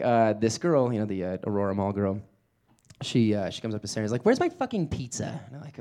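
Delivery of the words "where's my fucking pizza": high, snappy customer demanding her pizza; mock-rude demand; angry customer demand.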